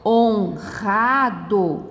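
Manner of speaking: very slow "Honrado", hon, ra, do; syllable by syllable. Shown as a demonstration. Teacher reading out separated words, slowly syllable by syllable. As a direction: break "Honrado" into syllables, strong guttural R. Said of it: "observe estas palavras separadas em sílabas".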